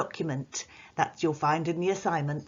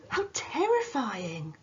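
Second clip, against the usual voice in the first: exclaiming; 6 semitones higher, slower than usual. Shown as a demonstration.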